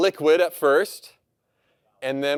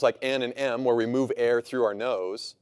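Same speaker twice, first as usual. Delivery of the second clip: normal talking voice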